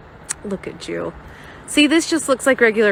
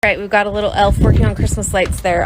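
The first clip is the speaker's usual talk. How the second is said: ordinary talk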